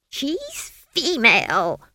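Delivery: high, loud, slow hoot. A narrator's reading. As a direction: hoot it out in outrage. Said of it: "hooted Granny".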